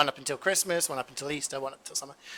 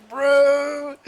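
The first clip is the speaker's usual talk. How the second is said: high, loud mock outrage; exasperated outburst; 12 semitones higher, 9 dB louder than usual. Customer refused ham at Subway, hammed-up exasperation. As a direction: imitate an outraged customer, much higher.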